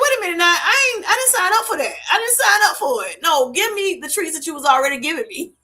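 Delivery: high, indignant pet voice; family pet protesting the loss of its treats, in a high, indignant voice; voicing a pet. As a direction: play the dog refusing the new diet, pitched well up. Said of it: "Like, they like".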